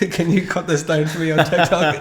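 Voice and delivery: amused retelling, voice lifted with laughter; laughing quote. Retold or imitated. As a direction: quote the message while cracking up. Laughs quoting teammate's message.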